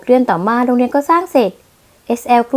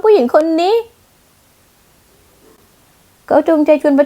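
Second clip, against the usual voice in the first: shrill accusation; 5 semitones higher than usual. Acted. Fiancée accusing rival publicly.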